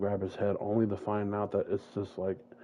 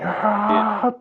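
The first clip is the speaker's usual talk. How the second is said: loud high furious scream; rage scream; 13 semitones higher, 12 dB louder than usual. Retold or imitated. Jalen discovering the fake body, raging scream. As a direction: erupt in rage. Jalen's eyes turn to fire.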